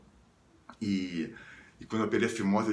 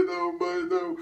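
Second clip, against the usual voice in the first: tearful pleading. Retold or imitated. Boy pleading with his mother, high tearful panic. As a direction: wail it like a panicked kid. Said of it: "chorando no banheiro".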